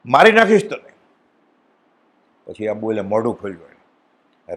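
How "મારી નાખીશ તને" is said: king's threat, pitched high and louder; a raging king threatening death; furious threat. Retold or imitated. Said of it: "એટલા ખીજાય છે રાજા". (ક્રોધિત રાજાની ધમકી, ઊંચા અવાજે)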